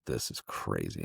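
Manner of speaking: hushed disbelief. Retold or imitated. Alone at the dark airport, a hushed stunned mutter.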